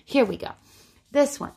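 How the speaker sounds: ordinary talk, nothing marked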